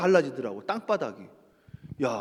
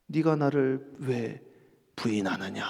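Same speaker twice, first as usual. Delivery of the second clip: slow, low, quiet rebuke; voice of God; 10 semitones lower, 8 dB quieter, slower than usual. Acted. God questioning Peter, slow and low. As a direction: drag it out low and hushed.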